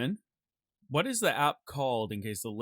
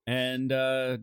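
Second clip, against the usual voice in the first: ordinary talk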